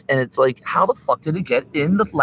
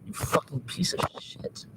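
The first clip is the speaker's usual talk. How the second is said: breathy hissed insult at a bug; hissed curse; 12 dB quieter than usual. Real, not acted. Streamer hisses at the bug in his laptop, angry whisper. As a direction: spit it out under your breath.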